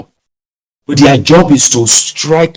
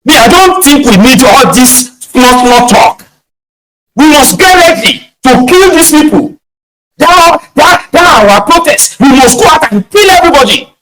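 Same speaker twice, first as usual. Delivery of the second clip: ape a frenzied troll calling for violence; pitched-up mock rant; mock screaming agitator; 12 semitones higher than usual. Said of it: "they're screaming there".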